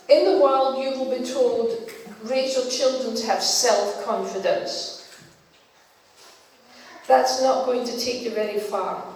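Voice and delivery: ordinary talk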